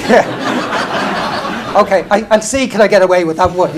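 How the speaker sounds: laughing through the words, loud and high; chuckling in disbelief at the idea. Retold or imitated. Laughing at his aide's suggested line for the press.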